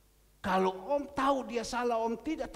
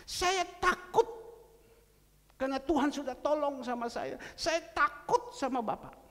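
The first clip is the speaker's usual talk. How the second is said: anxious promising man; 8 semitones higher, 5 dB louder than usual. Retold or imitated. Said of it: "janji lagi mengatakan".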